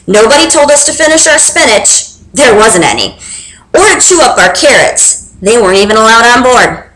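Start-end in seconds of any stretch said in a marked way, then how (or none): none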